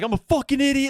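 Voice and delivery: high, strained self-berating cry; a mock-frantic high yelp at himself; frantic self-scolding. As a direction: yell at yourself in panic, high in the voice. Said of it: "I started punching shit".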